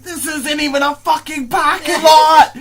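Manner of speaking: loud, high incredulous yelling; screaming. Retold or imitated. Student to the kid who came into class, screaming. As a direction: scream it in hysterical disbelief. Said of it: "I'm screaming".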